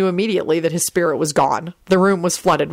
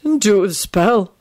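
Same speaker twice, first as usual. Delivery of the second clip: dazed, disjointed mumble; mumbling; 2 semitones higher, slower than usual. A narrator's reading. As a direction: ramble woozily in fragments. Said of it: "Neville mumbled".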